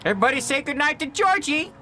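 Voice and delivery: high, sweet granny voice; old lady voice. Acted. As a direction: coo it like a doting grandma. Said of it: "I'm trying to do an old lady voice".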